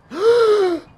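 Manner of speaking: loud, high gasp; sharp high gasp. Real, not acted. Loud sound while playing.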